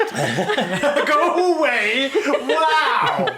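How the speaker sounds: slow high laughing echo. Real, not acted. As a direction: laugh it out slowly.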